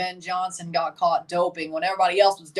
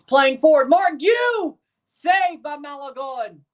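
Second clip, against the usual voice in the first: loud, high call of a scoring chance; excited shout; 8 semitones higher, 5 dB louder, slower than usual. Real, not acted. Fan watching the game calls out the chance with an excited shout. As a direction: yell the player's name as the shot goes in.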